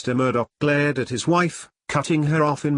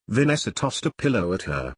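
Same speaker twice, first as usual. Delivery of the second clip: plain reading voice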